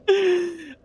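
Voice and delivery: high falling operatic laugh. Pretend. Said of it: "Operatic with gusto".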